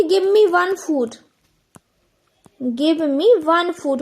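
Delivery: very slow, word by word; slow dictation. Shown as a demonstration. Teacher slowly dictates the wrong sentence to students. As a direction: spell it out slowly for the class. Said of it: "क्या आप कह सकते हो कि".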